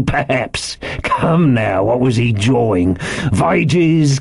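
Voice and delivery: sarcastic, badgering interrogation, rising high; slow mimicry building to sharp demands; mocking cry. A narrator's reading. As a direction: throw the answer back with scorn. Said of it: "cried Long John".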